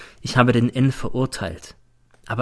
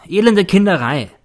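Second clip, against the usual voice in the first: higher, louder scornful jab on elende; scornful outburst; 8 semitones higher, 5 dB louder than usual. A narrator's reading. Teacher scorning the diary, loud and scornful. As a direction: spit out the contempt.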